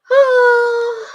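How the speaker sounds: loud, high tearful "oh"; real emotional cry. Real, not acted. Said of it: "I'm feeling emotional kind of cry".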